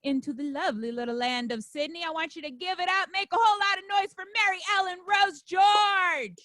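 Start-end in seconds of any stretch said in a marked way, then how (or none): 2.1-6.4 raised announcer call building louder; emcee hype call. Real, not acted. Emcee brings on a Sydney comedian, hype call. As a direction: build up to a big shouted name.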